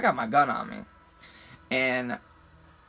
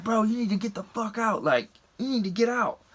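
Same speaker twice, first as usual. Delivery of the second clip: higher-pitched retold urging; urgent plea; 7 semitones higher than usual. Driver to buddy stuck in truck, retold urging. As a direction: press a friend to move, pitched up.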